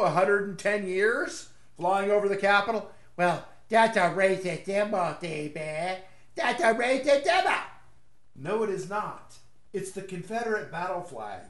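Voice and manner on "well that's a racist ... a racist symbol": put-on dopey whine, consonants mangled; mocking objector voice. Imagined critic objecting to the flag, mocking voice. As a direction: mimic a complainer with sloppy, childish consonants.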